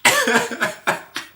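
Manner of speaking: shrieking laugh; high laughter. Real, not acted. Laughter at clip.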